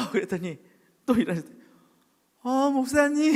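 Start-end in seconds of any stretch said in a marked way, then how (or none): 2.4-3.4 high, louder greeting breaking into laughter; laughing greeting. Retold or imitated. Retold joyful greeting. (웃으며 반기는 목소리)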